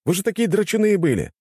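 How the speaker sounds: high-pitched protest; put-out, high complaint; indignant. A narrator's reading. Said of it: "возмутился я".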